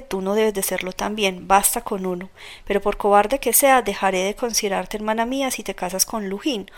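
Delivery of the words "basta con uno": ordinary delivery, nothing marked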